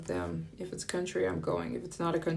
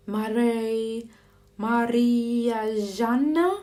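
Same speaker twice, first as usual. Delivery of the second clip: higher, louder French "Marie, Maria Jeanne?" drawn out; French mispronunciation; 6 semitones higher, 5 dB louder, much slower than usual. Retold or imitated. Imitating French drivers fumbling her name in French accent. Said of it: "they're like".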